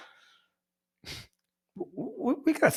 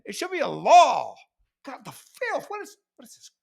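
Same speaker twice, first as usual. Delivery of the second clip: mock outrage; 8 dB louder than usual. Outraged rant, held.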